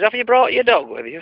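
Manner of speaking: high-pitched vet in a joke; a chirpy vet asking. Acted.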